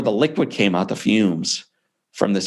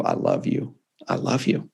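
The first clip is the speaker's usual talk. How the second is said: softer, breathy whispered love; tender whisper; 4 semitones higher, 5 dB quieter than usual. Retold or imitated. Father at the hospital bed whispering love to his son. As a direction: let it fall to a tender whisper. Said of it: "And then he says".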